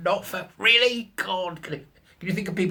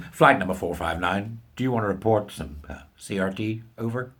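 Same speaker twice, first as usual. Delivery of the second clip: radio operator; 4 semitones lower, 3 dB quieter than usual. Pretend. Radio call parody again.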